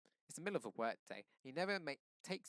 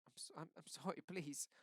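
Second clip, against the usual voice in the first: whimpering; 6 semitones higher than usual. A narrator's reading. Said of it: "he whimpers".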